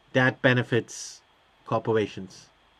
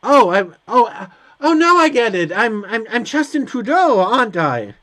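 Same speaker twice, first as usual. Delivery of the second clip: much higher and louder, it finally clicks; sudden realization; 6 semitones higher, 5 dB louder than usual. Real, not acted. Player realizing who they play, excited surprise. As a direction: blurt out the aha moment.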